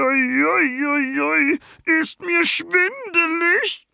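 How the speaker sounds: very high, slow, dazed creature voice; a tiny creature reeling after a crash; dizzy little creature. Acted. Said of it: "brummelt das zottelige Ding". (sehr hohe, langsame, benommene Tierstimme)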